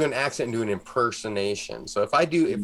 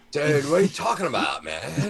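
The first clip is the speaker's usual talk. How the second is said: higher, hammed-up Californian dude; Californian accent; 3 semitones higher than usual. Host denying his accent while proving it, Californian accent. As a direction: protest in an exaggerated Californian surfer accent. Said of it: "I don't have a Californian accent".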